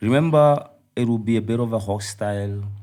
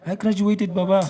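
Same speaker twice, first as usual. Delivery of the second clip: high, proud exclamation; triumphant announcement; 9 semitones higher than usual. Real, not acted. Guest answering whether he graduated, triumphant and high.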